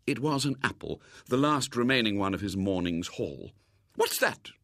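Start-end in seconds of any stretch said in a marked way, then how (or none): 3.9-4.5 high-pitched, sudden sharp question. A narrator's reading. Lady spots the apple in William's pocket, sharply. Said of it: "she said sharply".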